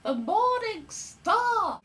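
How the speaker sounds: put-on very high, slow, wide-eyed child voice; awed small boy. Small boy answers his father in high slow wonder. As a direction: marvel like a little boy seeing a star. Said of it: "as a small boy, I went".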